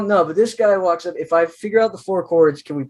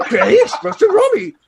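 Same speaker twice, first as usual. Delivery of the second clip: very high, laughing cartoon voice; Scooby answering Shaggy in a very high, laughing squeak; scared cartoon dog; 12 semitones higher than usual. Scooby-Doo's scared reply.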